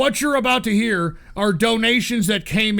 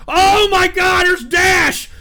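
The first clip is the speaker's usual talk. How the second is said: excited shout; 5 semitones higher, 4 dB louder, slower than usual. Real, not acted. Stunned by a surprise crypto donation.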